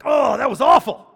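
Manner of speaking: high loud shouted imitation of grandfather; mock grandfather. Grandfather shouting to grandson after the game, loud mock imitation.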